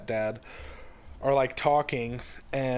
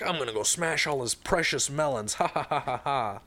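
playful threat ending in a fake laugh; gloat, then a staged ha-ha-ha; mock villainous gloating; 2 semitones higher than usual. Joe tells his sister his melon plan, mock villainous gloating.

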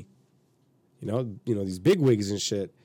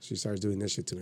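ordinary talk

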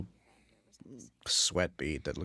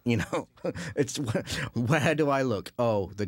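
laughing; 6 semitones higher, 5 dB louder, slower than usual. Retold or imitated. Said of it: "try and just go, "Oh!".

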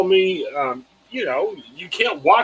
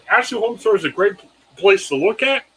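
normal talking voice

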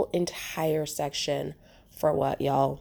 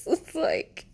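high laugh; 14 semitones higher, 4 dB louder than usual. Real, not acted. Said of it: "laughing over cider loss".

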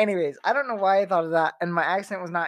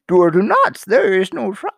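louder, halting Yoda voice; a hint of Yoda, louder; Yoda impression; 9 dB louder than usual. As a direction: try to sound like Yoda.

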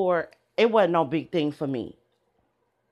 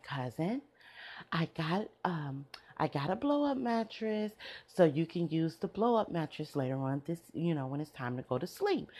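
much softer, gentle imitation of the cousin; softly, sweetly, like a kind hostess; 10 dB quieter than usual. Imitating the gentle cousin offering the blow-up mattress, soft. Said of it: "She's very gentle".

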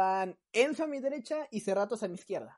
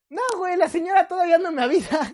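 higher and louder, stubbornly innocent; naive protest; 9 semitones higher, 6 dB louder, faster than usual. Retold or imitated. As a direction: protest like a clueless kid. Enzo protests, still waiting. (protesta ingenua)

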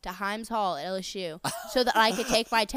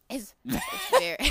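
laughing mock voice; 4 dB louder than usual. Friends mock her morning voice.

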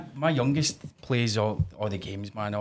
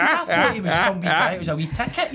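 higher, louder laughing disbelief; laughing scorn; 7 semitones higher, 5 dB louder than usual. Retold or imitated. Dad mocking the bully to his son, laughing. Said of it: "I says".

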